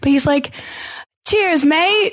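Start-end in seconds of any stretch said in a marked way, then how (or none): 1.3-2.1 a hammed-up British accent, lightly done; mock British "Cheers, mate" with a lifted "mate"; bad British accent. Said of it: "I can't do accents".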